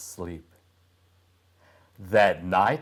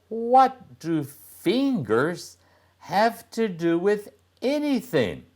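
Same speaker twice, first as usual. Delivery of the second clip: put-on boy's voice; 12 semitones higher, 3 dB louder than usual. Boy puzzled by girl.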